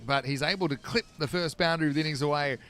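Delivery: brisk, lifted cricket call over the action; live play-by-play. Acted. Commentator breaking off the chat to call the shot, lively play-by-play. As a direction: call the shot as it happens, bright and quick.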